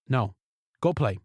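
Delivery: ordinary talk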